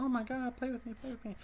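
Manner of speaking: high, eager puppy begging to play; excited dog voice. Retold or imitated. Streamer imagines his dog begging him to play, in a high eager voice. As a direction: squeal like a dog desperate for attention. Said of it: "the dog's gonna be like".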